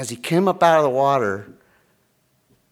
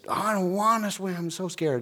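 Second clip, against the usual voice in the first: put-on scared kid; 7 semitones higher than usual. Voices his reluctant, scared kids.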